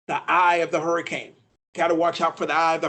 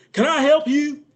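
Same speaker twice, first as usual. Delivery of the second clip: higher-pitched irritated waiter impression; Doctor Phil, upset; 6 semitones higher, 4 dB louder than usual. Upset TV doctor waits on a diner, mock agitated. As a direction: put on a testy waiter's voice. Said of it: "he'd be all upset".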